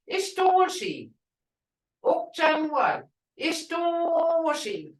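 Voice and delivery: Ishtoshi with a high, slow falling tone; tone demo. Shown as a demonstration. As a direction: say Ishtoshi slowly, pitch high then falling. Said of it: "Now listen to the tone".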